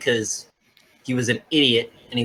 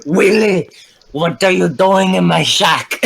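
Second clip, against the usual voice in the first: raised, higher mock Scottish ogre voice; Shrek; 5 semitones higher, 4 dB louder than usual. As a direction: play the indignant ogre. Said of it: "He sounds like Shrek".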